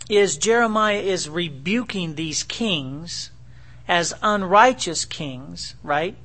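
ordinary talk

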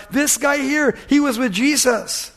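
high accusing outcry; mild mock scream. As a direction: pitch it up and point him out to everyone. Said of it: "She screamed it out".

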